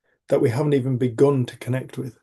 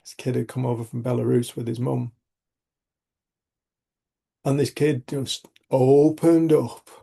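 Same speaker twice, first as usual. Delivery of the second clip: ordinary talk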